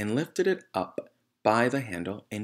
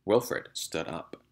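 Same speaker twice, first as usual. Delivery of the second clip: ordinary delivery, nothing marked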